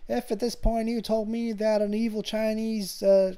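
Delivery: hammed-up Southern twang, incredulous; overdone Southern accent. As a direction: imitate her overkill Southern accent, pitched well up. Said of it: "putting that Southern accent on hard".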